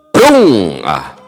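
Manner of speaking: ordinary delivery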